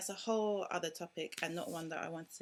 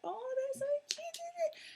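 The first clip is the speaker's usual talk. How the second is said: very high, slow, gushing coo; high slow coo of a stranger at the pram; mock cooing stranger; slower than usual. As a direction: imitate a stranger melting over a baby. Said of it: "he'll be like".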